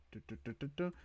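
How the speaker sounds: ordinary talk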